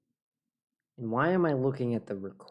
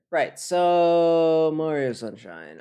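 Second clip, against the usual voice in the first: loud drawn-out announcement; 6 semitones higher, 7 dB louder, much slower than usual. Real, not acted. Announcing next game.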